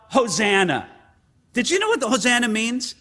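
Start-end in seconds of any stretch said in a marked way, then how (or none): none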